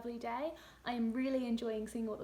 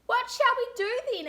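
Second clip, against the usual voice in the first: put-on much higher little girl asking; child's voice; 12 semitones higher, 10 dB louder than usual. Laura asking her mum what to do, high child's voice. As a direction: whine it up like a bored small girl. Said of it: "asked Laura".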